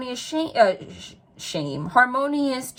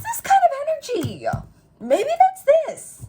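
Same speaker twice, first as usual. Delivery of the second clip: excited squeal; 3 dB louder than usual. Real, not acted. Gushing over the tarot reading.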